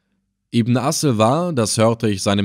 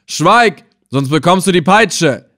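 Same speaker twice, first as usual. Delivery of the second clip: loud, raised threatening command; thundering; 6 semitones higher, 7 dB louder than usual. A narrator's reading. Slave hunter silencing the old captive, thundering. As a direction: thunder at him to cut him off. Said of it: "donnerte ihn Ibn Assel an".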